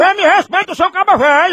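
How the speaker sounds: indignant shout. Real, not acted. Prankster shouts back.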